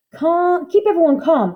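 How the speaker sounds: high, loud calls to calm the crowd; bellowing. A narrator's reading. Showman bellowing at a pushing crowd, loud. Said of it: "bellowed C J".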